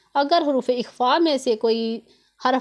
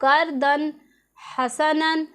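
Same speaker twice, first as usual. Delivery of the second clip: slow recitation of करदन हसनन with a clear नून; Quranic recitation; 3 semitones higher, much slower than usual. Shown as a demonstration. Teacher drilling the example for her students. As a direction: repeat करदन, sounding the नून plainly. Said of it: "जाहिर करना है तनवीन की आवाज़ को".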